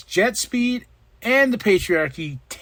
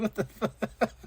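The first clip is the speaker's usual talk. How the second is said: quietly baffled Yuta; 4 semitones higher, 11 dB quieter, slower than usual. Retold or imitated. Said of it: "He's like".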